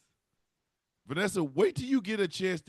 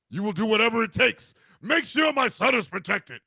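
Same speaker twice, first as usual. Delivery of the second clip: louder, higher decree; commanding boom; 4 semitones higher, 5 dB louder than usual. Pretend. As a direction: order it from above. Divine voice commands.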